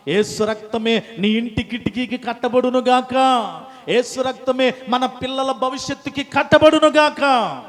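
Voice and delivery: from firm to loud, high proclamation; fervent pulpit declaration; rising blessing. Acted. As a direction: climb into a ringing blessing.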